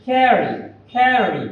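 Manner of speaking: ক্যারি drawn out slowly with its r sounded; R pronounced. Shown as a demonstration. Teacher modelling double R, slow ক্যারি with audible r. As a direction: stretch ক্যারি, keep the r clear. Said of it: "double আর থাকলে আর এর উচ্চারণটা হবে".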